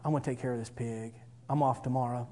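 low, hushed inner resolve; thinking to himself. Retold or imitated. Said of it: "I thought to myself".

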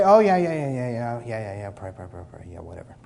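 yeah yeah whatever, fading away to nothing; dismissive mock answer. Mocking a lazy churchgoer who shrugs off prayer, trailing off dismissively.

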